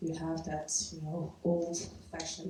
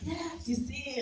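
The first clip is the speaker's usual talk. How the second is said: retold quote; 9 semitones higher than usual. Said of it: "it's like saying".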